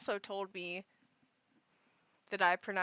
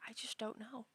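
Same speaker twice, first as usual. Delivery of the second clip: near-whisper, much softer; hushed, stumped; 16 dB quieter than usual. Real, not acted. Viewer baffled by the plot twist, defeated whisper. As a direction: sigh it out under the breath.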